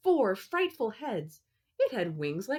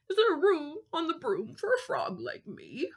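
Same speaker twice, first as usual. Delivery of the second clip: high froggy voice, croaking the question; croaky frog; 9 semitones higher than usual. Acted. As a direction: ask like a hopeful little frog. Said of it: "then said with a croak".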